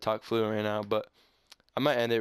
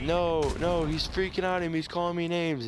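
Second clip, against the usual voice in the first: upset wife; 6 semitones higher than usual. Retold or imitated. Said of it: "But she's like".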